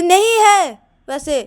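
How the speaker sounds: high, loud, drawn-out denial; insistent denial. Acted. Shruti contradicts the others, drawn-out loud denial. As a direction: stretch the refusal out, loud and high.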